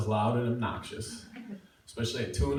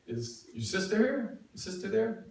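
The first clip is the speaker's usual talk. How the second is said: 1 nervous caller; 9 semitones higher than usual. Retold or imitated. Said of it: "her sister answers".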